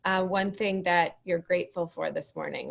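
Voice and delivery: ordinary talk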